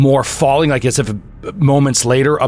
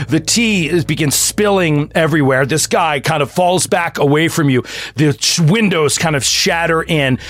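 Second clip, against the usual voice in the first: ordinary delivery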